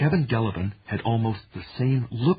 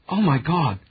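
shocked exclamation; 7 semitones higher, 6 dB louder than usual. A narrator's reading. Molly reacts in shock.

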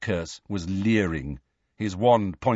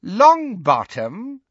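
high, drawn-out villainess question; Bellatrix; 10 semitones higher, 6 dB louder, much slower than usual. A narrator's reading. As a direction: savour the surname slowly, swooping high and loud. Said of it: "repeated Bellatrix".

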